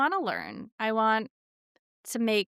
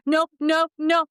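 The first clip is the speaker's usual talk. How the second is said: louder, high no-no-no; playful insisting child; 8 semitones higher, 7 dB louder than usual. Retold or imitated. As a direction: insist back, playfully topping mom. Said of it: "and he's like".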